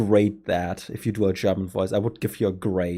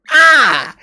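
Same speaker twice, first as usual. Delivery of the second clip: higher, hammed-up cartoon villain exclamation; Doofenshmirtz impression; 8 semitones higher, 5 dB louder, slower than usual. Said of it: "sound like Doofenshmirtz".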